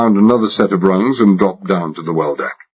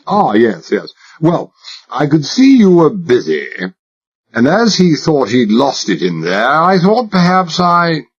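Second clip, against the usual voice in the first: put-on high-class English accent laid on thick, higher; exaggerated British accent; 7 semitones higher than usual. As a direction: play the posh Englishman to the hilt. Narrator voices himself as posh Englishman.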